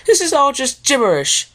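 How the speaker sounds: a theatrical ladylike wail; Rarity moaning. Acted. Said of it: "she moaned".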